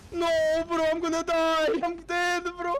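high, loud, slow panicked wail; despairing cry as the killer catches you; panicking gamer. Real, not acted. About to die in the match.

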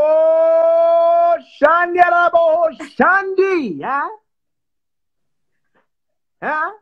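long, high, loud call across the room. Real, not acted. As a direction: hold the name out high and loud. Calling out to his fiancée.

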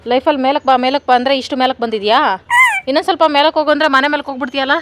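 loud, high teasing scold; scolding the pet. Real, not acted. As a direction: tell off the animal with mock exasperation.

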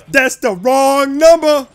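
loud, high, drawn-out holler; stretched, high-pitched mocking yell; taunting shout. Taunts game enemy.

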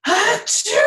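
high loud acted sneeze; big sneeze. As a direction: let the bear sneeze. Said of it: "that is the biggest sneeze".

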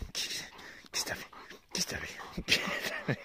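slow, breathy whisper; whisper to the dog. Real, not acted. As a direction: hush it to the dog, slowly. (chuchoté lentement au chien)

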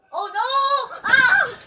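high yelp of dismay; real alarmed cry. Real, not acted. Runner failing an obstacle mid-course, alarmed. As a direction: cry out as it goes wrong.

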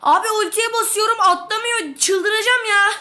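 loud, high exasperated complaint; frustrated yelling. Real, not acted. Gamer whose ultimate won't fire: loud high frustrated yelling. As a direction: shout at the broken button.

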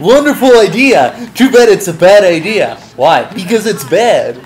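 mock announcer voice. Mocks game voice line.

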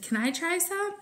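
a hint of a pleading little kid; child's voice. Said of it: "and Aubrey said".

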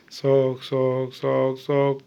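low, soft repeated chant; cold-game cue. Pretend. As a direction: drop low to signal she's off track. Hot-cold game, child far away.

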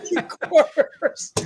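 retold order breaking up into laughter; laughing retelling. Storyteller quotes the chief's angry order, cracking up laughing. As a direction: laugh through the end, voice climbing high.

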